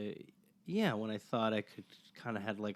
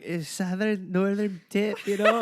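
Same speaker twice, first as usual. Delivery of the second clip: higher, rising faltering fake Scottish accent; 9 semitones higher, 5 dB louder than usual. Brothers caught bluffing a Scot, faltering Scottish accent. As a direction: flounder in a bad Scottish accent. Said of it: "we're being Scottish".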